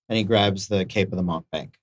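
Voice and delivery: ordinary delivery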